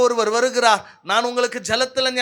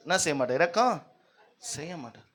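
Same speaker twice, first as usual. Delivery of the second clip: ordinary delivery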